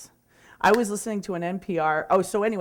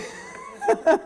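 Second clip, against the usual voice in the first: high laugh. Real, not acted. Laughing at the surprising praise.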